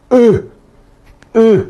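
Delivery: "U" pressed from the throat, high and tight; Guaraní guttural "U" shown to students; guttural vowel. Shown as a demonstration. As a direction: squeeze the vowel "U" out of the glottis. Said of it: "suena acá".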